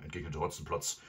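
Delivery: ordinary delivery, nothing marked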